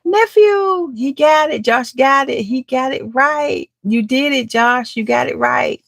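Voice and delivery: high, delighted cheering for Josh; excited. Real, not acted. Auntie cheering nephew Josh for getting it right, excited. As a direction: celebrate the win, voice up high.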